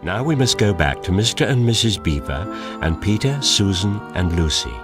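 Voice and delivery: plain reading voice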